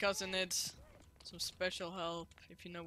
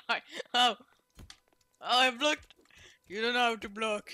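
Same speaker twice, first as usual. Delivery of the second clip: loud gloating; 3 semitones higher, 12 dB louder than usual. Real, not acted. Gloating mid-fight.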